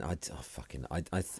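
ordinary talk

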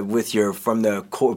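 ordinary talk, nothing marked

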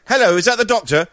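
a patient asking for the doctor; caller. Retold or imitated. Patient calling doctor's house.

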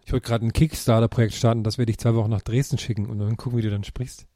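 ordinary delivery

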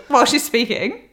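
ordinary talk, nothing marked

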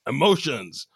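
high, hammed-up dramatic outburst; mock rant. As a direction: rant it like an overacting TV captain. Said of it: "as a rant".

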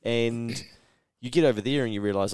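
ordinary talk, nothing marked

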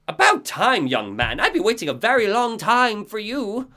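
put-on high, odd old-lady voice; Cynthia voice. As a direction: greet him like a long-awaited guest.

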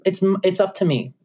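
casual. Retold or imitated. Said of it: "I treated it with that attitude".

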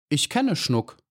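mildly strained casualness; forced composure. A narrator's reading. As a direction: make yourself sound unruffled. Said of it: "zwang sich zur Gelassenheit".

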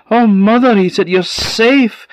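high, loud relieved cry. A narrator's reading.

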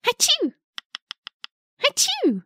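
high fake sneezes. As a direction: sneeze it out twice. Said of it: "an animal that goes".

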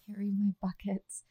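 breathy whisper. Pretend. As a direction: whisper it suggestively. Suggestive plug.

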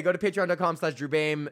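ordinary talk, nothing marked

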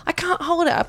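high, pleading small-child imitation; Wolfie. Toddler telling mum he's bursting, desperate child voice. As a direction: mimic a toddler who is desperate. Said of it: "He's like".

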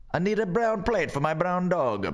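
high, put-on voice of a Roman craftsman; hammed-up ancient Roman voice; silly high Roman voice. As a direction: play a fussy mosaic maker picking plates. Voicing a Roman mosaic maker.

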